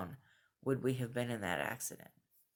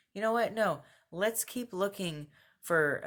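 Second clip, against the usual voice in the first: high-pitched voicing of a sudden second thought; higher, emphatic quoted thought; voiced inner hunch; 7 semitones higher, 3 dB louder than usual. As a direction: act out changing your mind, pitched up.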